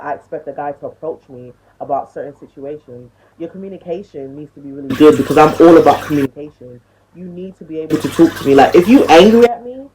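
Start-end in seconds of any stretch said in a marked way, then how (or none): 3.4-9.9 much louder, pushing the point hard; loud insistence. Real, not acted. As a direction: hammer the demand home loudly. Ranting about communication.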